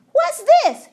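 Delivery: very high, loud startled cry; astonished gasp. A narrator's reading. Executioner gasps seeing another hat appear. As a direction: gasp in disbelief. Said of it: "he gasped".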